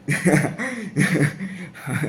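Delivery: high amused laugh trailing off; real laugh. Real, not acted. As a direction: chuckle at your own ramble. Laughs at own tangent.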